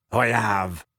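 low, gruff confession; grunted admission. A narrator's reading. Big man admitting his errand, low grunt. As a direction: grunt it out reluctantly. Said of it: "he grunted".

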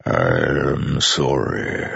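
very slow, immense godlike voice; Storm Father's voice. A narrator's reading. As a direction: let each word roll like distant thunder. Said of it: "the Storm Father said".

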